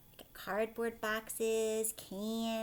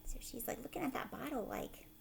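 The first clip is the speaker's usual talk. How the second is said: whisper; 2 semitones higher, 9 dB quieter than usual. Real, not acted. Aside whispered over story.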